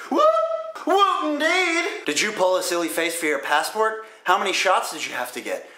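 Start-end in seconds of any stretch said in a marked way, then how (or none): 0.1-2.0 high, drawn-out whoop; mock cheer. Vlogger celebrating the birthday flight, high mock cheer. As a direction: celebrate it like a party.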